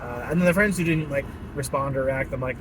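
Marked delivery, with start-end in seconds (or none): none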